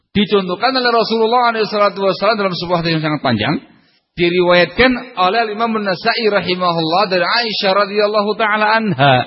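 high, pressing sermon delivery. Acted. Preacher urging husbands to understand their wives, fervent preaching.

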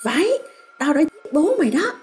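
high, harsh defiant shout; defiant confession. A narrator's reading. Mother confesses the murder to her son, defiant and high. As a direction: throw the confession in his face. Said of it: "hét lên một tiếng và mạnh bạo nói".

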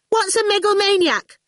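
put-on high-pitched child-like query; hurried question. A narrator's reading. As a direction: pipe up high to interrupt. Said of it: "Orphle asked hurriedly".

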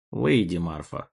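low, weary sigh of a command; tired sigh. Acted. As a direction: sigh it out, worn down. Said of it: "устало вздохнул Брюс".

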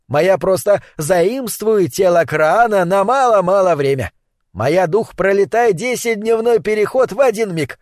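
put-on old sorcerer voice. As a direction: speak like a proud old witch doctor. Sorcerer Nlonga explains in broken speech.

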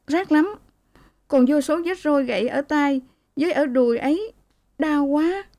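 high, pained cry of a hurt woman. A narrator's reading. Beaten daughter flinches under her mother's touch, high pained cry. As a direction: flinch and wail that it stings. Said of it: "Cô tránh và kêu".

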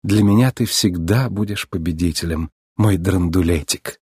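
low, affectionate murmur; gentle endearment, slowing at the end; tender. A narrator's reading. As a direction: reassure your beloved. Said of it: "нежно сказала она".

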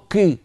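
كي with the qaf pushed forward toward a k sound; wrong qaf. Shown as a demonstration. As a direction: say كي halfway between qaf and kaf, the wrong way. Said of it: "ولا نقول كي". (القاف قريبة من الكاف خطأً)

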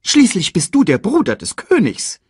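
high, loud, indignant reproach; scolding. A narrator's reading. Sasu reminds Scar of his duty, high loud scolding. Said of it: "tadelte ihn Sasu".

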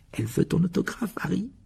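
high, amused lilt; teasing with a smile. A narrator's reading. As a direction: tease your friend playfully. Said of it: "dit Fred avec un sourire".

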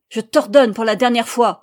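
loud, hammered final warning. A narrator's reading. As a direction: pound out the order as a guard. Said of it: "martela le gardien". (ordre martelé, très fort)

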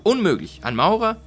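high, loud disbelief; incredulous. Acted. Fortunato incredulous at mason claim.

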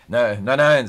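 sudden loud, hasty no's to cover a slip; flustered denial. Acted. Teacher startled by the name, loudly flustered denial.

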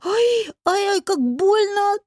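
high, drawn-out wail of pain; pained cry. A narrator's reading. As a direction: wail as if the belly hurts terribly. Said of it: "схватился за живот и закричал". (кричит от боли, высоким голосом)

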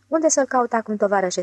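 ordinary delivery, nothing marked

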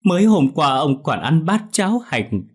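high, loud wailing cry; wailing lament. Acted. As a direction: wail over the body. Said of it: "khóc bù lu bù loa".

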